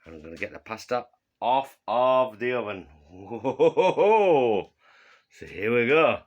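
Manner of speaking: usual speaking voice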